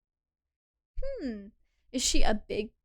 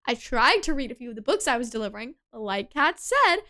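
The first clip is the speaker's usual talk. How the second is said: ordinary talk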